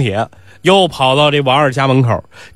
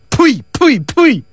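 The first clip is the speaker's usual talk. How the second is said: mock spitting; 11 semitones higher, much slower than usual. Ni Si spits at the doorman.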